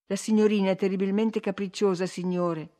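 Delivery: ordinary delivery, nothing marked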